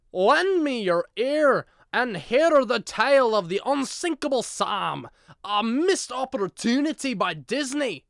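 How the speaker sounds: booming theatrical narrator, high and loud; grand storyteller. Acted. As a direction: announce it like an epic bard. Dramatic storyteller opening quote.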